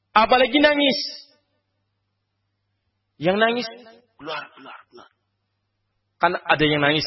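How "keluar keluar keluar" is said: much softer, urging mourners out under the breath; hushed order. Retold or imitated. Preacher voicing a man ushering weeping relatives out, hushed. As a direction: shoo the criers out quietly without disturbing the dying. Telling mourners to leave the deathbed.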